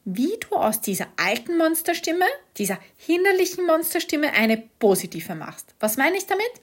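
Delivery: usual speaking voice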